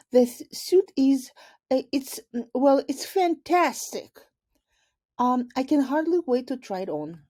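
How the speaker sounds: high, nervous and tripping over the words; flustered stammer. Acted. Principal fumbling for praise of an invisible suit, high flustered stammer. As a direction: squeak out forced praise while panicking inside.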